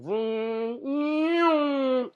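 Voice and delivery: high, loud roaring vroom; imitated eighteen-wheeler roar; truck vroom. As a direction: roar like a truck barely missing.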